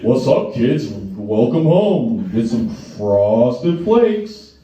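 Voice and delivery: slow, drawn-out creepy mascot voice; creepy Tony the Tiger. Retold or imitated. Tony the Tiger greeting the kids at home, slow and creepy. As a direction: lure the kids in, very slowly.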